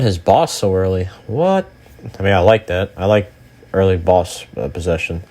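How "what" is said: high startled what; sudden shocked squeak; surprised. Real, not acted. Boss summoned early.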